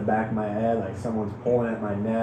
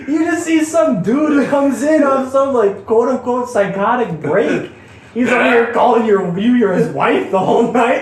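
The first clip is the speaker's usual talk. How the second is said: laughing; 12 semitones higher, 8 dB louder than usual. Real, not acted. Imagining nurse's view, laughing.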